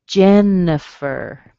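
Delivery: "Jennifer" slow, with a schwa in the middle; schwa model. Shown as a demonstration. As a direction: say "Jennifer" as JEN-uh-fer, slowly. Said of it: "Notice you don't hear the I".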